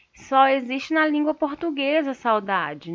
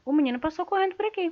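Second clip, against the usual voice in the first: quick, higher-pitched neutral reading; neutral statement; 6 semitones higher, much faster than usual. Shown as a demonstration. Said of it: "tá neutro". (leitura neutra e rápida)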